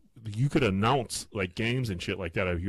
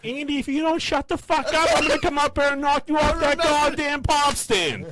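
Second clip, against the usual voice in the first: high, furious mom shout, imitated; mom's yelled threat; 4 dB louder than usual. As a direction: yell a threat as an enraged mother. Mom yelling threat at heckler.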